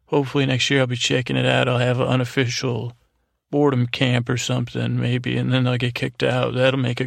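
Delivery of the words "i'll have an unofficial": ordinary talk, nothing marked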